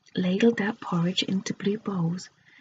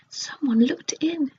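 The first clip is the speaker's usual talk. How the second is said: higher, alarmed; startled; 5 semitones higher, 4 dB louder than usual. Acted. Goldilocks after screaming at a face in the window, alarmed. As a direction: blurt it out in fright.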